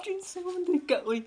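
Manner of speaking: hammed-up sob through the question; mock crying. Wife asks the bottle who it is, mock crying. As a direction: whimper the words like a wronged wife.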